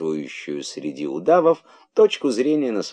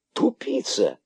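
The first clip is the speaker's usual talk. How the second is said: очень высокий изумлённый переспрос; переспроси тоненько, не веря услышанному; не веря ушам; slower than usual. A narrator's reading. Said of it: "не веря своим ушам". (ошеломлённо, высоко)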